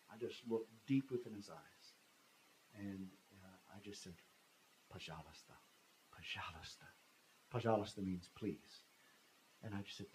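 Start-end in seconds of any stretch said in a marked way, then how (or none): 4.9-6.9 low, hushed pleading; soft plea. Retold or imitated. Traveler looking deep into his accuser's eyes, hushed plea. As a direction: plead very quietly, word by word.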